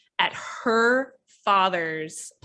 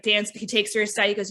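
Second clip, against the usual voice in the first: ordinary talk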